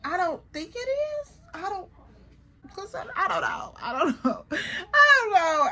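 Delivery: high, hesitant hedging that breaks into laughter; squirming denial. Retold or imitated. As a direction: play dumb in a high, comic squeak. Acts out squirming out of answering.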